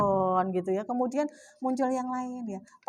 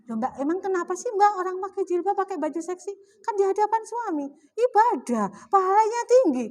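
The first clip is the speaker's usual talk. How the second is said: higher, defending herself, rising to a firm finish; insisting; 7 semitones higher than usual. Retold or imitated. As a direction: argue the case brightly to the shop girl. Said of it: "Saya pernah dipandang heran".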